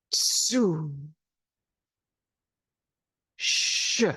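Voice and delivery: slow drawn-out exhaled healing sounds, each one separate; six healing sounds. Shown as a demonstration. Teacher leads the class through exhaled healing sounds. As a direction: push each sound out on a long breath, pausing between. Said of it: "I will be mirroring you".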